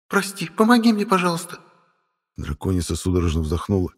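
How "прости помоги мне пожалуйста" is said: put-on high, pleading girl's voice. Sister begs brother for help, high and pleading. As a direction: beg him in a high little voice.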